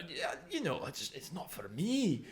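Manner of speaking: put-on loop the lines lazily in a Glaswegian drunk voice; mock Glaswegian drunk looping his lines; Glaswegian accent. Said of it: "try and do an accent".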